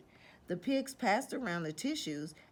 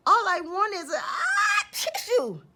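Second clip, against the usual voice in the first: loud high wind-up into a sneeze; building sneeze; 13 semitones higher, 9 dB louder than usual. Acted. Sick wolf asking for a tissue, sneeze building.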